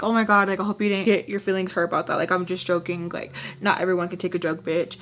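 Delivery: ordinary delivery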